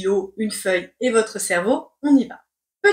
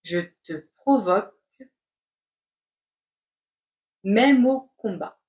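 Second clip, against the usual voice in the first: chunked, with a long pause mid-phrase; slow dictation. Shown as a demonstration. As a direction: dictate it in two parts. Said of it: "C'est parti pour la dictée".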